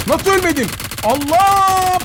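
high, stunned cry of disbelief; shocked disbelief. Real, not acted. Gamer shocked his enemy survived the grenade, high disbelieving cry. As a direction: gasp out that he survived.

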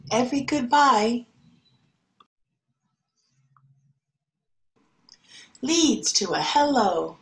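gentle picture-book reading voice; storybook reading. Acted. Teacher reading a picture book to her class, gentle.

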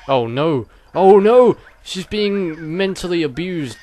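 shrill alarmed cries, high and loud; mock panic. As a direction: cry out in fake horror.

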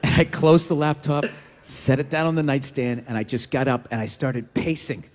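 very low, menacing growl; mock seething, dropped way down low; simmering rage. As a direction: hold the fury down deep in the voice. Rage rising after the sad video.

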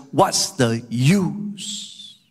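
drawn-out, very slow rhetorical question; resigned despair. Acted. Psalmist doubting the use of clean living, slow resignation. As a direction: voice the psalmist's futility, stretching each word. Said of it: "Look at how he says it".

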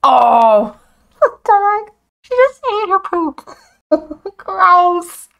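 high, loud, laughing disgust; real laughing shriek of disgust; shocked laughing outburst. Real, not acted. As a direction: burst out laughing in horror. Dog eats its poop.

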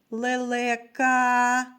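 "лелека" with the final а held long; last sound stressed. Shown as a demonstration. Teacher asking children for the last sound, drawing out the final а of "лелека". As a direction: stretch the closing а of "лелека". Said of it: "останній звук у слові".

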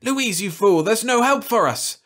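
horrified despair. Acted. Jim horrified, raging at Louise. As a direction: rail at her in horror.

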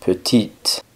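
Petite ending on T, the E silent; sounded final T. Shown as a demonstration. Teacher repeats the feminine form, Petite with sounded T. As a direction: say Petite as "puh-TEET". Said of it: "pronunciando la T pero no la E".

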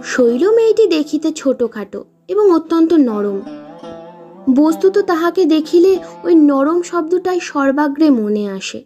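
ordinary delivery

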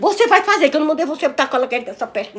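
angry command. Real, not acted. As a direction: lay down the law, loud and high at the start. Mãe impõe ordem à filha. (bronca mandona, voz alta)

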